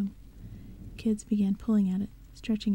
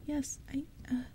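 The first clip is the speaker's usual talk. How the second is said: choked reply; 5 semitones higher, 8 dB quieter than usual. Acted. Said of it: "her throat tightened, choking off her words".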